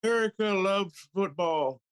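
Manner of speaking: high, drawn-out politician impression; put-on speechmaker's voice, pitched high; mock political speech. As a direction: proclaim it like a feeble rally speaker. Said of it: "I wanna hear the speech".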